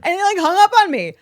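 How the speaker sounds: indignant rush. Real, not acted. Telling a friend how her husband hung up, fast and high. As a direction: blurt it out indignantly and quickly.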